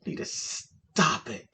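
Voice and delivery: slow, hushed warning without voice; husband's warning. Retold or imitated. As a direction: imitate a husband quietly warning his wife. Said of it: "He's looking at me like".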